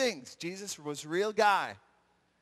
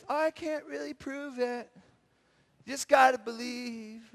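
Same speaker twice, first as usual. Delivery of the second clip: put-on, slightly raised voice; mock feeble Jesus; 3 semitones higher than usual. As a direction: give a joking, feeble excuse in a put-on voice. Made-up feeble answer from Jesus.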